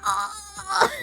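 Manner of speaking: high, soft, long "ohh"; drawn-out "oh" of realization. Retold or imitated. Streamer imitating friends finally understanding her mistake, drawn-out realization. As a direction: stretch out a mock "ohh" as it dawns. Acting out their dawning realization.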